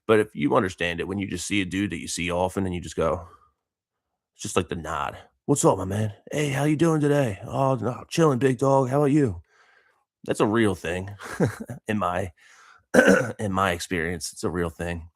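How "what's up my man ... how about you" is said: higher-pitched buddy-to-buddy hello; friendly gym greeting. Acted. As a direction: toss out an acted gym greeting.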